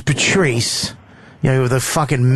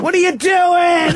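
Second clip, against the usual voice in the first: mock yell; slower than usual. Retelling the standards fight, yelling at Patrice. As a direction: hurl it at him mid-argument.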